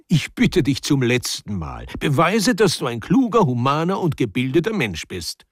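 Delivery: high-pitched final appeal; urgent pleading. Acted. Man begging Mitja one last time, urgently pleading. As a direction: implore him, rising high and insistent.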